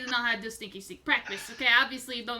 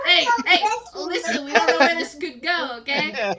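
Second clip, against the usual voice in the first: loud brash fairground hustler; carnival barker; 4 semitones higher, 12 dB louder than usual. Acted. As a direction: bark it at the crowd like a huckster. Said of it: "Why do you sound like a carnival barker".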